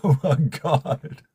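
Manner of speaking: slow, drawn-out exclamation through laughter; laughing disbelief. Real, not acted. Streamer overwhelmed by viewers' generosity, laughing in disbelief. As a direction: laugh it out, stretching the words.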